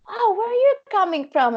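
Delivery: high called question from the boat; calling back. Retold or imitated. The jet boat guy calling to the paddler, high. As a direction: yell back a curious question.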